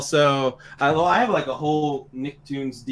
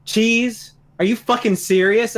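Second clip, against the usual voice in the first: mock coworker scorn; 8 semitones higher, 4 dB louder, slower than usual. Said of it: "everyone looked at me like".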